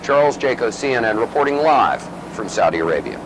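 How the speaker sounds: booming sign-off voice; parody news anchor. Acted. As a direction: sign off like a TV reporter. Mock sign-off.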